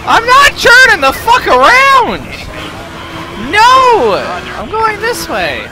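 high loud stubborn shout; real defiant shout; defiant yell. Real, not acted. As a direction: refuse the GPS furiously. Defying the GPS.

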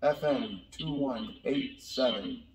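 slow, tinny electronic helmet voice filter; helmet voice changer. Shown as a demonstration. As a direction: speak slowly through a cheap helmet voice changer. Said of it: "So again, not very good".

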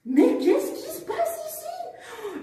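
high, slow, astonished cry. Acted. Cali hears everyone quarrelling, high astonished cry. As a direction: gasp at the chaos.

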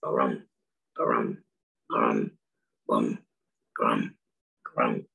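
very low, rumbling croaks; low booming bullfrog call; deep frog croak. Retold or imitated. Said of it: "you hear them".

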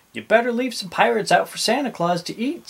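brisk, lilting motherly suggestion; mother's advice. A narrator's reading. Mother to Jim about Santa's treats, lightly voiced advice.

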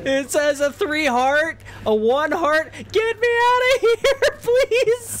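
high laughing cry for help; panicked laughing plea. Real, not acted. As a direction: laugh through the panic, begging.

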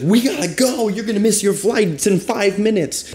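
very high, loud mock yell of an urgent woman; imitated screaming. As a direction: shriek a hurry-up warning in imitation. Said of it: "ran up to me screaming".

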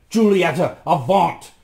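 loud, high banishing shout; defiant cry. A narrator's reading. Rasmus banishes the witch, loud and defiant. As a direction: cast her off with force. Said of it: "cried Rasmus".

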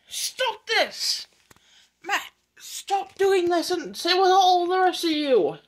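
high, loud, drawn-out protest; scolding shout. Acted. Milk tanker telling off the bumping trucks, shouting. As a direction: order them to stop at a high yell.

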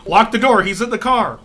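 high, loud mock warning yell; mock frantic yell. Co-host yelling at Tracy to lock the door, mock frantic. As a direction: yell a warning at the dumb friend.

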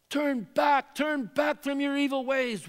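rising cry of a grieving God; pleading call. Retold or imitated. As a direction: call out to a wayward people. Preacher voices God's plea.

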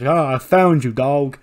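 gleeful. Acted. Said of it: "Then R-Truth was like".

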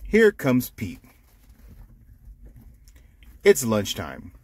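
ordinary delivery, nothing marked

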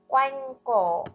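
Quanh cổ with a full anh rhyme in quanh; careful pronunciation. Shown as a demonstration. Teacher modelling Quanh cổ for pupils to repeat. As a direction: model Quanh cổ, ending quanh on a clear nh. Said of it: "chú ý phát âm ở vần Anh trong tiếng quanh".

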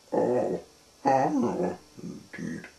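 lightly voiced sniffy character; sniffed reply. A narrator's reading. Miserable lookalike answers Mister Hooper, mildly sniffy. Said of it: "sniffed the person".

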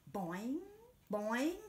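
slow, bouncy boing boing; spring the sounds up like a jump; playful cartoon bounce; springy boing. A narrator's reading. Hare leaping up to pears.

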